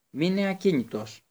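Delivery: put-on boy shouting at the fleeing traitor to stay still. A narrator's reading. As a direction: bark the order to freeze. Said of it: "του φώναξε ο Χάρη".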